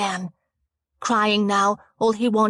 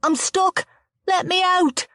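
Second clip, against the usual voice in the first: high, loud panicked cry; trapped boy begging through the door, high and loud; 12 semitones higher, 4 dB louder than usual. A narrator's reading. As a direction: scream to be let out. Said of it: "Panic took over".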